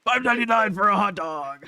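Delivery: hyped high ad read; monster truck ad. Acted. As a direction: sell it like a rally ad. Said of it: "Monster Truck Rally".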